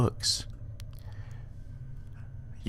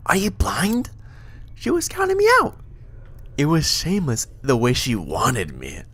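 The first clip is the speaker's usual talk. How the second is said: high, loud indignant shout; indignant skull shout; 8 semitones higher, 4 dB louder than usual. Acted. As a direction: squawk it out, offended. Morte indignant outburst.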